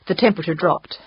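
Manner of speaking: ordinary delivery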